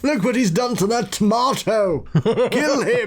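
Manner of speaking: high, hammed-up outrage; play-acted, high-pitched indignation; mock outraged accuser. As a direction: cry out like a mob demanding punishment. Acts outraged mob over tomato.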